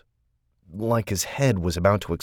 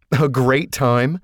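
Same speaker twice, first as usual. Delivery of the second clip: slow, high, loud incredulous echo; disbelieving echo; 6 semitones higher, 5 dB louder, slower than usual. Acted. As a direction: spit his words back slowly. Shane echoing in disbelief.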